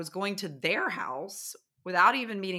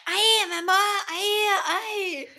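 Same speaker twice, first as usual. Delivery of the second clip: mock naive girl; 6 dB louder, slower than usual. Her confused self.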